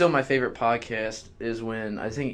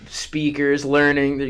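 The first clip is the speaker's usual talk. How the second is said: ordinary talk, nothing marked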